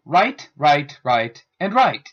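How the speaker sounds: right said four times, each the same way; homophones said in turn. Shown as a demonstration. Teacher demonstrating the same-sounding words right. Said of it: "For example".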